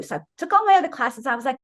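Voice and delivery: ordinary talk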